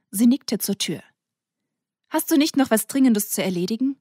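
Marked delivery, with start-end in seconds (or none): 2.1-4.0 higher, louder sarcastic hint; mock-polite brush-off; pointed dismissal. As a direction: show him the door with sarcasm. Milla dismisses Colin, nodding at door.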